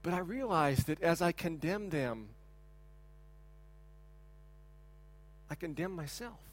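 hushed, humbled admission; quiet confession. Real, not acted. Confesses he is the same.

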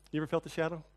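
quiet, downcast preacher reflection; hushed confession. Real, not acted. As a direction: let it sink in quietly like a confession. Quiet reflective sermon moment.

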